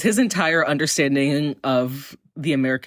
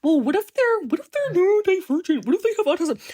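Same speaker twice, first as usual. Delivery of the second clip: much higher, mocking nasal-free whine of a critic; mocking whiny objector. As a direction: parody the objector, pitched way up. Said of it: "that they're like".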